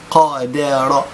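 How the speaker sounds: usual speaking voice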